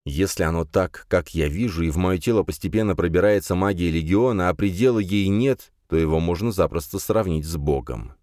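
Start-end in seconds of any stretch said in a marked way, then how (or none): none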